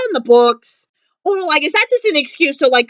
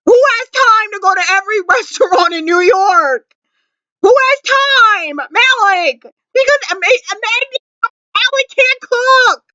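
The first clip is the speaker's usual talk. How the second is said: laughing rant; 8 semitones higher than usual. Real, not acted. Laughing rant about Alec's cooking.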